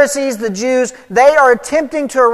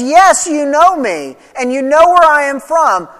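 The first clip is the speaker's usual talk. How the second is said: crying out; 4 semitones higher, 6 dB louder than usual. Retold or imitated. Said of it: "with a loud voice cried out".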